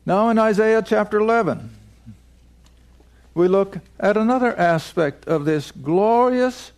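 ordinary talk

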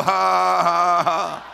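loud scornful ha ha ha; mocking laugh. Preacher laughing at the devil's lies. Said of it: "You go".

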